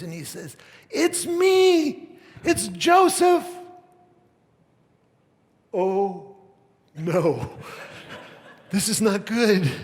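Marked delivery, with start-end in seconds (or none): 0.9-3.5 much higher, much louder, slow cry of recognition; emotional reveal shout. Acted. Joseph reveals himself to brothers. 5.7-9.7 higher and louder, half-laughing mock dread; mock panic. Brothers terrified at the reveal.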